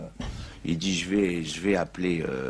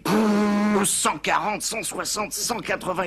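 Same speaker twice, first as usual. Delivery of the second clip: wild acceleration; 12 semitones higher, 3 dB louder, slower than usual. Acted. Speeding off in the car.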